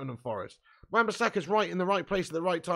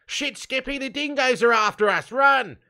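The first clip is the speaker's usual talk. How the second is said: high, hammed-up Australian alarm, growing louder; mock Australian accent; 9 semitones higher, 9 dB louder than usual. Streamer jokes with Australian viewers about dingoes, mock Australian accent. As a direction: yell a warning in a put-on Aussie accent.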